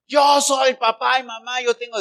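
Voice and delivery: loud, high boastful claim; bossy boast. Pretend. Preacher mocking a bossy parent, loud and boastful. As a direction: proclaim it like the self-important head of the family.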